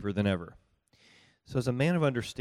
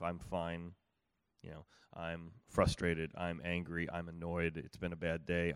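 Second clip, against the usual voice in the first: low glum listing; 6 semitones lower, 7 dB quieter than usual. Retold or imitated. Tired spouse giving the usual five answers, low and soft. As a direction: lower and softer, a worn-out rundown of feelings.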